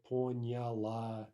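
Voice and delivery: Поняла drawn out, stress on the final ла; slow careful model of Поняла; slow stress demo. Shown as a demonstration. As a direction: stretch Поняла and land on ла. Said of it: "the only question is the stress".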